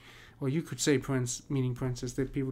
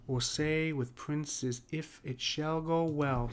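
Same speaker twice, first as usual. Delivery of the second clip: slow, measured verse reading; rhythmic scansion reading; 2 semitones higher, much slower than usual. Shown as a demonstration. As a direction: spell out the line's rhythm, syllable by syllable. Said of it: "this is the rhythm of the line".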